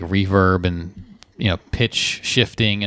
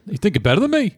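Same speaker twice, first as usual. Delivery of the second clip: much higher, mocking challenge; put-on catchphrase voice; 9 semitones higher than usual. Host mimics the catchphrase, higher and mocking. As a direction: squeak it out as a sassy taunt. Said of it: "Hayward's laugh".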